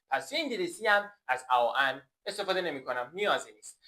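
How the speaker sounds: normal talking voice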